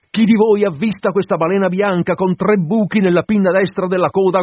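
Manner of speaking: loud, high proclamation to the crew; captain's rallying shout; full-voiced shout. A narrator's reading. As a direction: bellow the challenge so every sailor hears. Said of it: "gridando a piena voce".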